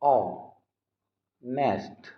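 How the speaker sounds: ऑनेस्ट drawn out very slowly, silent h; ऑनेस्ट spoken slowly and softly with no h; slow demonstration. Shown as a demonstration. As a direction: stretch ऑनेस्ट slowly, opening on the vowel. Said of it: "जब pronounce करेंगे तो आ".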